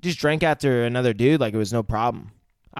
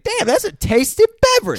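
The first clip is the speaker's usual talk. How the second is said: high exclaiming; 5 dB louder than usual. Retold or imitated. Jackson quote retold.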